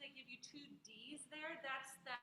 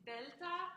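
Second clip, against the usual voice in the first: "belta" with the "TA" pushed louder; accented last syllable; 5 semitones higher, 6 dB louder than usual. Shown as a demonstration. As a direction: punch the final syllable of "belta". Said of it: "there's an accent on that one".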